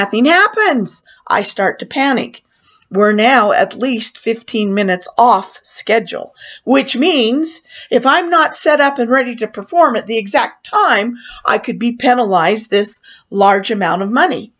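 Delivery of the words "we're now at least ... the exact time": ordinary delivery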